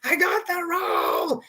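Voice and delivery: high, slow triumphant shout; screaming for joy. Retold or imitated. Scene: at a colleague's farewell, shouting the news she got the role. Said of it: "like, screaming".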